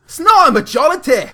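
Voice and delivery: high, loud indignant yell; mock outraged shout. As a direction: rant like a furious politician.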